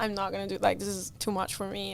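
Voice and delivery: low-energy, very soft resignation; fed-up, softly retold protest; so over it. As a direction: give up quietly, voice dropped. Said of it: "my co-driver was so over it".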